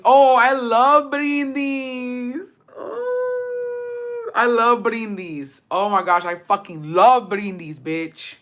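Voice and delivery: slow, high drawn-out oh; gushing. Real, not acted. Gushing to friends about loving Brindis. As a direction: stretch the oh out in delight.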